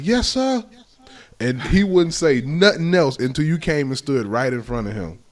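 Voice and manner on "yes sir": high, meek yes sir; obedient kid. Retold or imitated. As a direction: answer like a scared obedient kid. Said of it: "and you'd be like".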